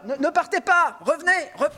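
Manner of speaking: high, loud plea, hammed up; mock-desperate calling out. Host begs fleeing listeners to come back, mock-desperate shouting. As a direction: call them back in pretend panic.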